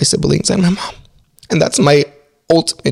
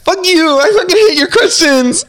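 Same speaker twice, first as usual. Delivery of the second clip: high, much higher playful fake anger; mock outrage. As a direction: squeal it like pretend fury at a friend. Teasing outrage at host's question.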